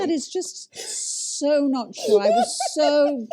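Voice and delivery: loud, high, amused objection; laughing protest. Real, not acted. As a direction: protest loudly through laughter. Protesting colleague's joking account of coaching.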